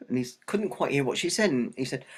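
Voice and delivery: plain reading voice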